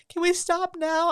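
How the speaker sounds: put-on high, slow whiny child voice; tired kids' plea. The boys begging to stop, high slow whine. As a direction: plead like a worn-out small boy. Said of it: "they were like".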